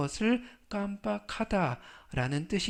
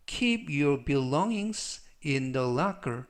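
slow model sentence; 2 semitones lower, much slower than usual. Shown as a demonstration. Teacher reading the example sentence aloud, very slow. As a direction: Keep your belongings in the locker read very slowly. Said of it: "slow model reading of sentence".

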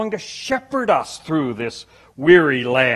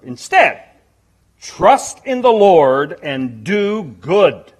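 solemn scripture reading; 5 dB louder than usual. Real, not acted. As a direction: read the verse like a proclamation. Reads Psalm thirty-seven aloud.

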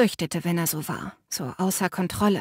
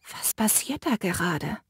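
hushed, much softer question; whispered; 6 dB quieter than usual. Acted. The narrator asks Finn softly, so the sister will not hear. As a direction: breathe it under your breath. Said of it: "flüsterte ich Finn zu".

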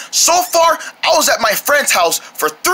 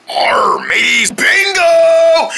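pirate voice; 6 semitones higher, much slower than usual. Pretend. Pirate treasure hunt play.

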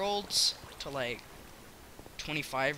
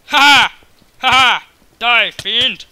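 loud, high mock-heroic yell; battle shout; 7 semitones higher, 21 dB louder, much slower than usual. Gamer striking down an opponent, mock-heroic battle shout. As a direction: attack with shouted cries. Fighting in the game.

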